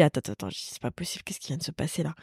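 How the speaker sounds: whispered shock after meeting her; stunned whisper. Retold or imitated. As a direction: hush it like you can't believe it.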